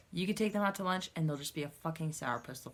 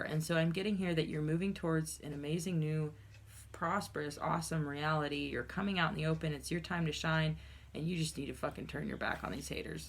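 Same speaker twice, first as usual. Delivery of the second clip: ordinary delivery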